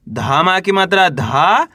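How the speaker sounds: very slow, धा held and rising; spelling aloud. Shown as a demonstration. Teacher sounding out the third letter, slow with long धा. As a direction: spell it out, stretching धा upward. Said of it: "एक बार फिर से देखिए".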